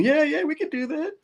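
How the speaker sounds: high voice jumping right in; mock eager couple. Couple jumping in on the offer, mock high voices. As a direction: pitch it way up, eagerly agreeing. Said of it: "jumping in".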